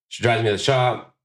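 ordinary delivery

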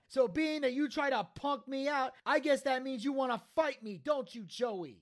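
passionate promo. Retold or imitated. Taz defies Joey and the company, passionate promo. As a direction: hammer out the defiance like a promo. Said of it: "Taz coming out with the passionate promo".

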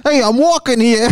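hammed-up New Yorker shouting at traffic; a mock New York street yell; New York accent. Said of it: "Or the New York".

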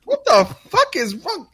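high-pitched indignant imitation; exaggerated incredulous outburst; mock disbelief. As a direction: act out an offended young guy. Acted disbelief of upset co-worker.

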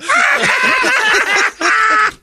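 high shrieking laugh. Real, not acted. Laughs after mock knighting.

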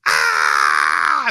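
loud guttural yell; guttural scream. Acted. Man showing the quarantine scream, guttural yell. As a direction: scream from the gut. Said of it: "just guttural".

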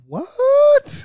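high, loud surprised yelp; shocked what. Real, not acted. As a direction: squeal it in disbelief. Sees the picture.

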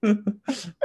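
high squeaky laughing punchline; squeaky joke. Pretend. Host jokes about paying listeners, squeaky laugh.

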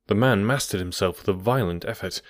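usual speaking voice

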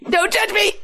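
quick, very high-pitched mock yelp; mock scream. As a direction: blurt it out in a high, panicky squeal. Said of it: "I'm just screaming in the background".

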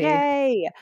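high, stretched-out cheer. Real, not acted. Host joining cheer for writers, high and long. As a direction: join the hurrah with a long high call. Cheering ratified union contract.

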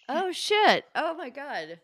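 high startled oh shit; surprised. Real, not acted. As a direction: caught off guard, blurting it out. Surprised at Taurus answer.

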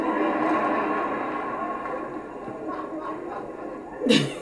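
long breathy unvoiced laugh; airy laugh. Real, not acted. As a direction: let out a soft wheezing laugh. Laughs at sleeping churchgoers.

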